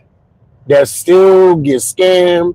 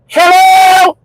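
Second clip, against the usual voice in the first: squeaky, much higher hello, shouted; mock confused hello; 13 semitones higher, 3 dB louder than usual. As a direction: play dumb with a high squeal. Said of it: "And then I'm like".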